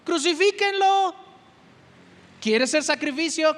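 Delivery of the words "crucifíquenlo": raised, higher mob cry; preacher voicing the crowd's yell for crucifixion. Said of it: "Le estás gritando a Cristo".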